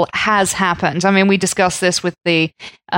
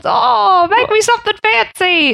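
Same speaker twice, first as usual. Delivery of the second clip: gold miner voice; 11 semitones higher than usual. Pretend. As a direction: brag like a freshly rich gold miner. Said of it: "Did you like my gold miner voice".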